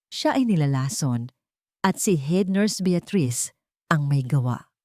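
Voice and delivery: usual speaking voice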